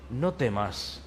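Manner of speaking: softly, low and hushed; calm a frightened person gently; soft reassurance. Acted. Angel hushing a frightened human, softly.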